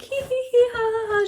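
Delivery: high-pitched mock giggle; imitated laughter. Lily drinking and having a good time, mock laughter. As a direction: act out a silly, put-on laugh. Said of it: "you know, laughing".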